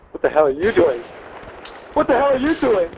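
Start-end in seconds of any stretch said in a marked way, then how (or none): 1.9-2.9 exasperated yell. Real, not acted. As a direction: yell it at the teammate in disbelief. Repeated rising outrage.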